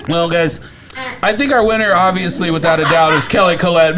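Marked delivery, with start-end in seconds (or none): none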